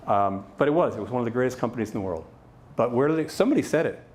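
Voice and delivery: ordinary delivery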